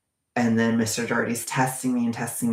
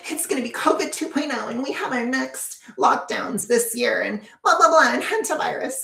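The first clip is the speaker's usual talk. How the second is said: much higher, fast, mocking whiny voice; mocking astrologers; 12 semitones higher than usual. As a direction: parody them as silly doom prophets. Mocking astrologers' doom predictions.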